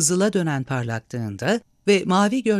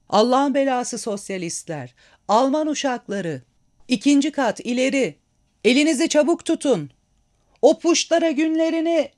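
rising, much higher angry battle cries; rally the men up the stairs, louder each line; mob shouting; 9 semitones higher, 4 dB louder than usual. A narrator's reading. Soldiers in the hall shouting as they storm upstairs.

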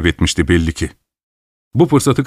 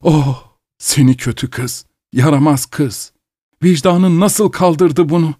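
moaning reproach; 8 semitones higher than usual.

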